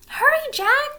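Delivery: high, loud urgent call; cried. A narrator's reading. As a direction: yell it up from the ladder. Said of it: "cried Annie".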